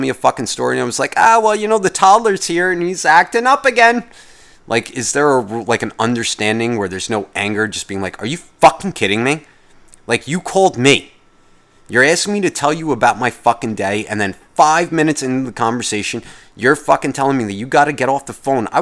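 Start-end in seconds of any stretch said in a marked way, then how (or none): 1.2-4.0 high, loud frazzled parent excuse; harried parent voice. Retold or imitated. Harried parent brushing off call. 8.2-18.7 annoyed rant. Retold or imitated. Ranting at friend's brush-off.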